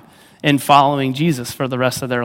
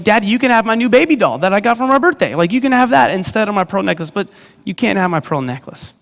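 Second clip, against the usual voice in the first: put-on high, fast bargaining child voice; pleading little girl; 6 semitones higher, faster than usual. Jenny bargaining with her daddy at bedtime, high, fast pleading child. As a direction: rush the offer like a kid bargaining. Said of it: "she's like".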